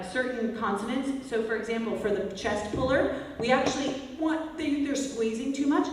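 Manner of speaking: usual speaking voice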